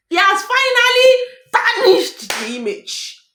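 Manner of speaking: loud, opening high then dragging out; indignant outburst. Real, not acted. Host laments the man tarnishing their image, loud indignant outburst. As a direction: burst out loud, stretch the final words.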